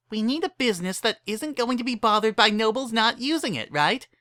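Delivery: eager Pinkie pitching in; Pinkie Pie voice. Acted. Pinkie urges friends to help, bubbly.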